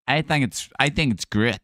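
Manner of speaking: normal talking voice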